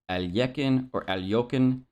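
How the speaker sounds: Alyekin as al-YEK-in, Alyokin as al-YOK-in; corrected pronunciation. Shown as a demonstration. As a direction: say Alyekin and Alyokin with a 'ly' glide. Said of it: "it should be closer to".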